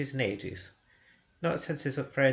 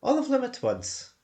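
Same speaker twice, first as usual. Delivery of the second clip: much higher, lively hobbit voice; cheery hobbit; 12 semitones higher, 4 dB louder than usual. Acted. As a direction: toss it off brightly as a hobbit. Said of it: "said Bilbo".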